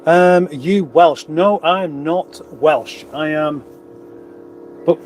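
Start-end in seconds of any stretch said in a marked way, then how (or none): none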